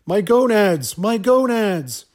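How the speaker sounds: high, loud wail in imitation; imitated scream, stretched and falling at the end; mock scream. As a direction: cry out in hammed-up anguish. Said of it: "begins to scream out".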